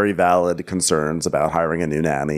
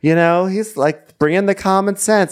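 mock admiring squeal; 11 semitones higher, 3 dB louder than usual. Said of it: "You're like".

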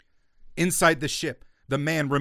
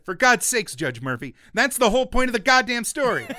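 exasperated retort; 8 semitones higher, 5 dB louder than usual. Retold or imitated. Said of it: "So I said".

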